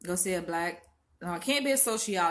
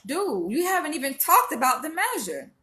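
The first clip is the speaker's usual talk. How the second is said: exasperated retort; 7 semitones higher, 3 dB louder than usual. Retold or imitated. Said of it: "But I'm like".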